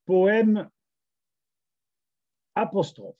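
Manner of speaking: high, ringing title announcement, word by word; declaimed title. Acted. Poet presenting the poem to online friends, declaiming. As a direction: announce the poem with stagey pauses. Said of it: "nous allons vous le déclamer".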